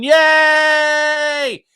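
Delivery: high, loud cheering crowd; mock crowd cheer. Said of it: "other people are going".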